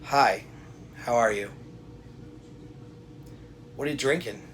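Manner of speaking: unhurried alpha tone; low, deliberate. Shown as a demonstration. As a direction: take your time, calm and measured. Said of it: "that's a lower deliberate tone of voice".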